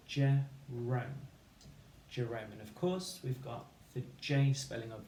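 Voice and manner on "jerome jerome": usual speaking voice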